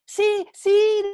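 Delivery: high, loud eager reply; trapped man answering the voice, eager; eager desperate yes. Acted. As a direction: jump at the voice.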